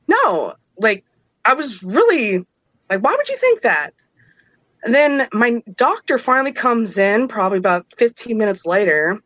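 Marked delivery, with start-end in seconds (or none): 3.0-3.9 high-pitched, baffled protest; incredulous protest. Retold or imitated. Patient to nurses suspecting her husband, incredulous protest. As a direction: act out pushing back, pitch leaping up.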